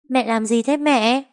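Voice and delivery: put-on high, slow, puzzled child; a small girl asking her mother; little girl voice. A narrator's reading. Monkey asks her mother what she is doing, high and childlike.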